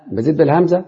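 ordinary delivery

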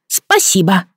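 put-on high, dutiful child's thank-you; obedient little boy. A narrator's reading. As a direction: chime in like a well-trained toddler. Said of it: "послушно закончил за неё Фергюс".

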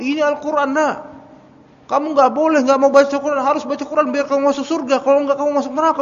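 put-on high, fast, rising lecture to a child; mock exasperated scolding; scolding parent. Father scolding a toddler who won't recite, high and fast. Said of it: "ngomong gitu".

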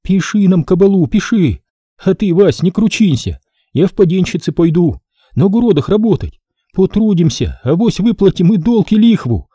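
a touch of tears, wife comforting husband; through tears. Acted. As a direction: beg and soothe tearfully. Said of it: "сказала сквозь слёзы".

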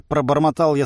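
ordinary delivery

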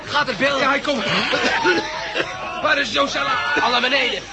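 urgent shouting. Acted. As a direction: rush everyone down the stairs. Panicked evacuation shouts. (gehaast en luid roepend)

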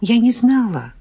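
ordinary delivery, nothing marked

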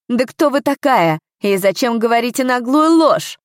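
high, loud, indignant questioning; affronted official raising his voice; angry outburst. A narrator's reading. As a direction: fire the accusation back. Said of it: "гневно выпалил тот".

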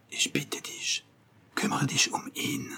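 soft unvoiced whisper, barely audible; whispered German. A narrator's reading. Simon whispering in German to Louis while passing, hushed. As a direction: slip the words secretly in a whisper. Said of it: "lui glissa en allemand".

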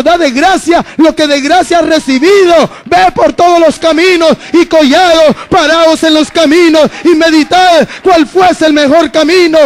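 preacher urging the church to go out and evangelize; fervent preaching. Acted. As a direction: drive the message home to the congregation. Preaching at full force.

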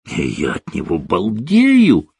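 slow swoon with a swooping peak; mildly hammed-up infatuated cry; gushing exclamation. A narrator's reading. Orlando once she hung up the phone, gushing exclamation. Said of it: "воскликнул Орландо".

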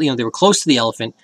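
normal talking voice

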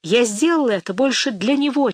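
plain reading voice

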